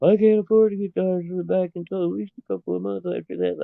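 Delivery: high-pitched imitation of a panicking wife; put-on high voice, imitating her fretting; freaking-out wife. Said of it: "'cause she's freaking out. She's like".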